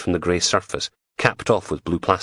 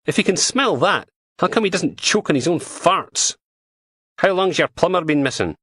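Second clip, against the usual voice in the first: higher, brisk Scottish policewoman voice; PC Martin voice; 11 semitones higher than usual. Acted. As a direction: voice her as a blunt, scornful dog handler. Martin jokes about the dog.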